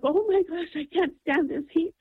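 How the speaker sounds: high-pitched retold fretting; exasperated complaint. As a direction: act out being unable to bear it. Retold distress at burning.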